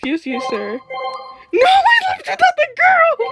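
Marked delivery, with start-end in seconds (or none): 1.5-3.3 high, loud laughing cry; laughing dismay. Real, not acted. Gamer realising she left the girl, loud laughing wail. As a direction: wail at your own blunder.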